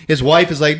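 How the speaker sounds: ordinary delivery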